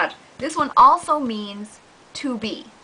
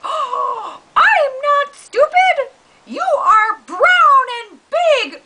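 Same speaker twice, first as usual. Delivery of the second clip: much higher, louder, slow cartoon cat; whiny cat yelling; 7 dB louder, much slower than usual. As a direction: wail back at the dog. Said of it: "the dog and the cat".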